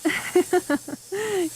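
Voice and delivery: a drawn-out fond laugh; amused laugh. Acted. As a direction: laugh fondly at the client. Amused after teasing the client.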